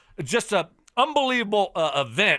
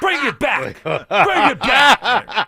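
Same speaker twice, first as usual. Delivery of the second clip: laughing; 5 semitones higher, 8 dB louder, much slower than usual. Real, not acted. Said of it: "That's what I'm stoked up about".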